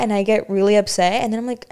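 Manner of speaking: normal talking voice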